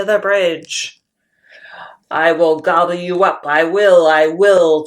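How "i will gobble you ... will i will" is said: loud bellowing troll threat; a booming troll roar. Acted. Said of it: "Troll bellows".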